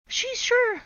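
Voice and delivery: put-on high, loud children's voice; eager kids. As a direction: answer fast like eager kids. Kids answering.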